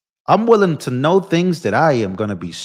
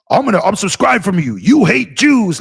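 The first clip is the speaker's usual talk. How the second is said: mock outraged followers; 5 semitones higher than usual. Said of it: "I get a whole bunch of".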